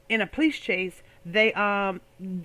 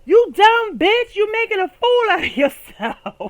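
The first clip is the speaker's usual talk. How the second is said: high, loud, slow yell; yelling from the car; 10 semitones higher, 8 dB louder, slower than usual. Retold or imitated. Men in a passing car yelling insults at her. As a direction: shout it out of the window. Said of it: "and say".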